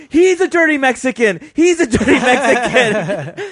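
high, loud, laughing imitation; mock drunk accusation. Storyteller imitating the drunk woman pointing him out, loud. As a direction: shout the accusation, cracking up. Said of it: "He's like".

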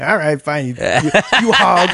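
slow, laughing through the words; laughing retort. Retold or imitated. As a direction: chuckle out the insult.

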